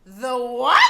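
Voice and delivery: imitated indignant outburst; mock outraged mom. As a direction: throw it back as an affronted mother. Mom turns round, offended by insult.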